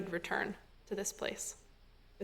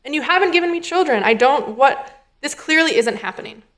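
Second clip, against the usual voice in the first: put-on higher, agitated retelling of an old man's complaint; upset pleading voice, higher in pitch; worked-up Avram; 5 semitones higher than usual. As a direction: act out Avram getting worked up. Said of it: "you can feel him pretty worked up".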